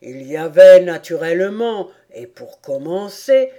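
loud, emphatic turtle voice; tortue professorale. A narrator's reading. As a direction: announce it self-importantly. (tortue qui énumère avec poids)

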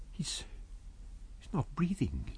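soft, faltering words; hushed dread. A narrator's reading. Kemp checking the body, hushed dread. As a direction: barely voice the news. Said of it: "he said".

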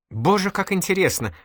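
high-pitched gushing interest; delighted exclamation. A narrator's reading. Girl enthusing over her guest's parents, delighted. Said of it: "всплеснула девушка пухлыми руками".

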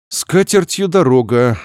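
ordinary delivery, nothing marked

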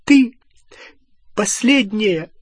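high, angry, each word a separate blow; slow, high-pitched scolding in bursts; furious word by word. A narrator's reading. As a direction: punch out every word on its own with pauses. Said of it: "Каждое слово сопровождалось ударом".

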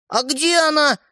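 high-pitched startled outcry; crying out. A narrator's reading. Second brother asking after the missing toy, crying out. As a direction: cry out, pitch jumping up. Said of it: "одновременно с ним вскричал Светлик".

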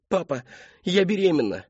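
high, strained outburst; desperate confession. A narrator's reading. Cornered daughter confessing to her father, high desperate cry. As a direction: blurt it out in desperation. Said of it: "хлынули слёзы".

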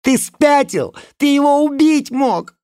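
high, loud furious shout; shouting angrily. Acted. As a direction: scream at him in outrage. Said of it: "кричала". (гневный крик)

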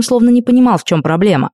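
plain reading voice

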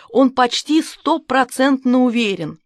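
plain reading voice

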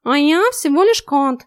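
slow, high, cheeky snort; snorting cat. A narrator's reading. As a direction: sniff it out like a smug cat. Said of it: "фыркнул наглый зверь".

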